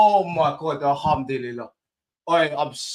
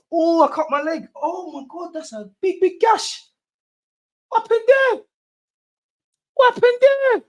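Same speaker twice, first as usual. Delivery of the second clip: very high, wailing exclamation; high-pitched alarm. Real, not acted. Fan finding he cut his leg celebrating, high-pitched wail. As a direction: squeal it out, far above the usual pitch.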